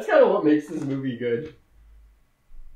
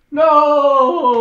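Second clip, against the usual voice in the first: loud, high, drawn-out no; mock cry of protest; 8 semitones higher, 8 dB louder than usual. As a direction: wail an objection as a horrified character. Howard's retold horrified objection.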